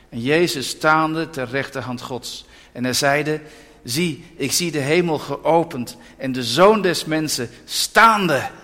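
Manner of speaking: slow, solemn reading from the Bible; scripture reading. Acted. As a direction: read the verse solemnly, word by word. Reads Acts aloud from the Bible. (plechtig voorgelezen Bijbeltekst)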